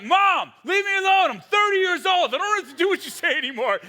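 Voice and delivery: loud, high exasperated yell; whiny teen shout. Retold or imitated. Grown son yelling at his mother, mock exasperated shout. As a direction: yell back like a fed-up teenager. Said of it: "And he's like".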